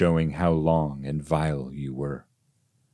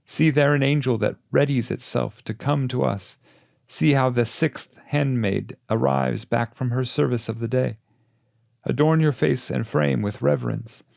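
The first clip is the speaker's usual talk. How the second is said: higher, insistent guide pressing him to hurry; Virgil urging; 7 semitones higher, 3 dB louder than usual. A narrator's reading. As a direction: lift the pitch and press the pupil on. Virgil urges him onward.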